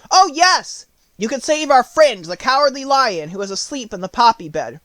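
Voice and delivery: high, loud eager offer; eager quick reply. Acted. The Scarecrow eagerly tells the queen about the Lion. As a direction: jump in with a bright idea. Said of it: "said quickly".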